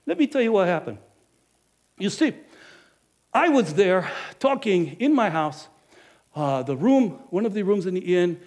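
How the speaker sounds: ordinary talk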